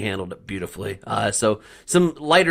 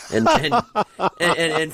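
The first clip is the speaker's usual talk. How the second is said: laughing through the words; giggling, high-pitched and breathless; 7 semitones higher, 3 dB louder than usual. Real, not acted. Radio host laughing while retelling a coach's press conference.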